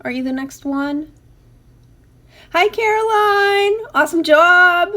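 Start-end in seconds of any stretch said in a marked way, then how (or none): 2.5-4.9 excited praise. Real, not acted. Host cheering a viewer's craft, loud high gush. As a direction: gush over her work.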